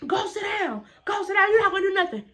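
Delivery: high, loud imitation of a bossy order; mock angry teacher. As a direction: mimic a teacher yelling in your face. Teacher ordering her down, in her face.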